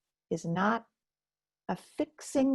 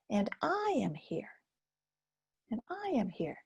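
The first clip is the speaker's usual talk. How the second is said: calm presence; 6 dB quieter than usual. Shown as a demonstration. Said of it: "to say".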